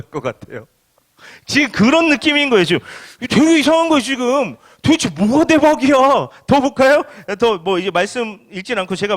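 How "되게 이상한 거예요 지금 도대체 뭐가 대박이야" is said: loud, high-pitched disbelief; incredulous protest. Acted. Preacher protesting the absurd idea of blessing to the congregation, incredulous. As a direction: raise pitch and volume, baffled by it. Preacher voices bewilderment at the paradox.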